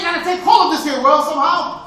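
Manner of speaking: loud, declaiming stage voice; stage actor. Acted. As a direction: project it to the back row. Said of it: "Man say".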